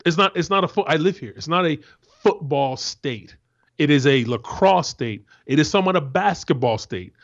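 loud, forceful, heated rant; angry rant. Real, not acted. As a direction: hammer each point home in real anger. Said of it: "I understand why you're so angry".

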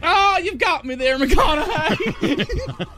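slow drawled line with a laugh; stretched-out mock Texan; Southern rival. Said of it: "play the other roles".

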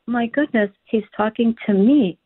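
usual speaking voice

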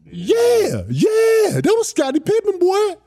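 very high, loud excited yell; mock shouted praise; imitating Zeke. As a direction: hype him up in a squeal. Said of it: "it was Zeke".

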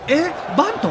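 a sudden high "huh, a bunt?"; surprised. Retold or imitated. Reporter in the press box sees the unexpected bunt, surprised. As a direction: caught off guard, pitch jumps up high.

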